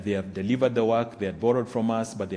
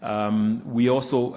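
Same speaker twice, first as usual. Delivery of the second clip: usual speaking voice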